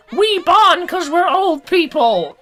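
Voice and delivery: put-on high, slow old-timer voice; old person voice. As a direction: quaver it like an old lady. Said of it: "they're just like".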